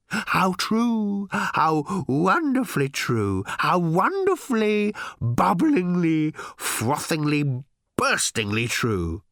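high, slow, escalating gush; overjoyed robot. A narrator's reading. Fawning robot praising Ford's remark, in raptures. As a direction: savour every adverb in swooning ecstasy.

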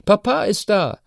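put-on high, bright child's call from the next room; young daughter shouting Papa's home; girl calling out. A narrator's reading. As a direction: call out to announce Papa like an excited daughter. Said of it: "hörte er die Stimme seiner Tochter Chiara rufen".

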